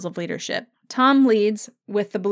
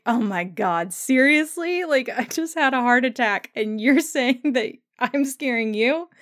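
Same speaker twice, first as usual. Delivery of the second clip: mock indignant; 3 semitones higher than usual. Imitating Claire, indignant.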